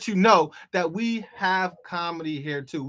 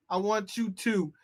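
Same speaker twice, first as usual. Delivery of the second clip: ordinary talk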